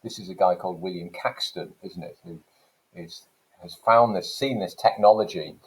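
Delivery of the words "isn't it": normal talking voice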